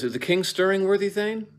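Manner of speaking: high hammed-up question; played for a laugh. Shown as a demonstration. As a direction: pitch the question up high for comic effect. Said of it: "if Macduff says".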